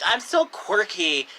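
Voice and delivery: slow, singsong mockery; mock quirky voice. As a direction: tease them in a cutesy voice. Mocking parody of the line.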